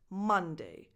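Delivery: Monday slow with MON strong and day light; stress on MON. Shown as a demonstration. Teacher showing learners first syllable stress. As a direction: lean on MON, let day fade. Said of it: "the stress is always on the first syllable".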